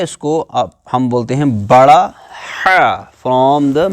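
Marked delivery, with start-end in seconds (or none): 2.7-3.0 ہا long, with a tight breathy friction in mid-throat; big Haa from mid-throat. Shown as a demonstration. Teacher shows the big ہا, from the throat's centre. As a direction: squeeze ہا out of the middle throat. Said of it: "center of the throat".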